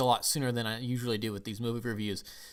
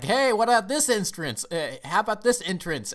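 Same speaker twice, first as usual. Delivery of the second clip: higher, louder showy call; show-off character; 9 semitones higher, 5 dB louder than usual. Acted. Dragon rider showing off his entrance, hammed-up boasting. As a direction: announce yourself grandly, mocking a braggart.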